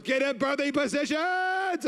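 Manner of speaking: high, slow mock shout; hammed-up high commanding yell; preacher's command. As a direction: bellow it like a fiery preacher. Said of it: "and then Jill goes".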